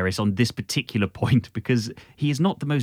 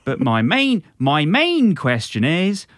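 put-on Norfolk accent; much slower than usual. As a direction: lay the Norfolk accent on thick, slowly. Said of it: "crack out your most broad Norfolk accent".

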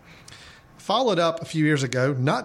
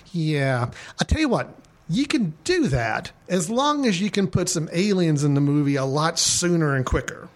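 higher studio executive voice, a bit quick; high-pitched nasal voice; 3 semitones higher than usual. Retold or imitated. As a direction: play the pushy studio suit, higher. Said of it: "a little more high-pitched, uh, nasally voice".

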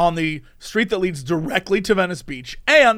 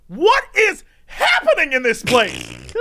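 slow, higher, stunned disbelief; bewildered; 7 semitones higher than usual. Retold or imitated. Narrator reacting to the creepy man, slow stunned disbelief. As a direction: drag it out in disbelief.

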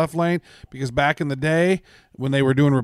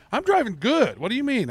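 higher, mock indignant; arguing with an Albanian cop, high mock indignation; indignant protest; 9 semitones higher than usual. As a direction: protest your driving innocently.

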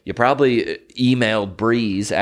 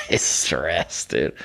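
usual speaking voice

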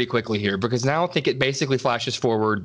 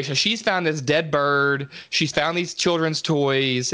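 ordinary talk

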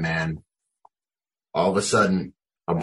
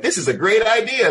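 high, sarcastic cheer; mock confidence. Rider on a roller coaster, high mock cheer. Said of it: "you're like".

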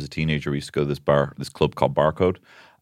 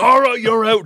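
much higher, louder command; bouncer bark; 13 semitones higher, 7 dB louder than usual. Retold or imitated. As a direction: throw them out with authority. Bouncer ejecting wrestlers.

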